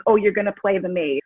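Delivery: normal talking voice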